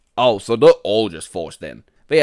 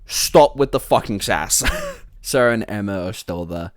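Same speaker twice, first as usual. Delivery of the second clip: ordinary talk, nothing marked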